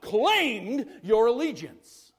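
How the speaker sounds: loud, high-pitched preaching at full force; impassioned preaching. Real, not acted. Preacher telling the congregation God has rescued them, impassioned preaching. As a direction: drive each phrase out emphatically.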